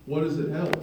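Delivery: ordinary talk, nothing marked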